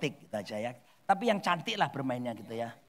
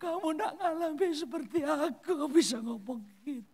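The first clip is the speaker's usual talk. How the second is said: mock tearful protest; 10 semitones higher than usual. Widow protesting to friends who never suffered this, mock crying. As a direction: drag it out in imitated weeping. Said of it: "malah tambah nangis".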